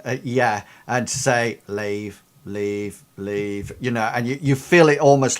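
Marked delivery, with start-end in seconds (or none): 1.7-3.7 soft, low murmur, near a whisper; Athena. Acted. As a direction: murmur each word separately, close to the ear. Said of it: "like, whispering in your ear".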